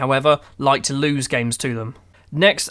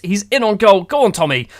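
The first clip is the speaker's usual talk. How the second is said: louder, rising shout as the chance opens; excited cheering; 5 semitones higher, 5 dB louder than usual. Real, not acted. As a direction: urge the striker on, voice lifting. Striker through on goal.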